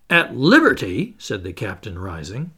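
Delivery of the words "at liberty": indignant echo. Acted. Captain rises, indignant.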